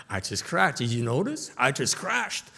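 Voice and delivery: put-on a boy boasting to grown-ups, high and eager; proud little boy. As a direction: play a kid bragging about his crash.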